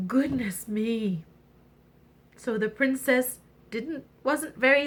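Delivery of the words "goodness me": mock gasp. Narrator's shocked aside.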